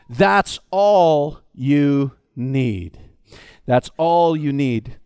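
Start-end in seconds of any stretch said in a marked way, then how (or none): 0.1-3.0 very slow, low, stress on all; slow emphatic. Shown as a demonstration. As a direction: lower and very slow. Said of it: "first take, pilot's line".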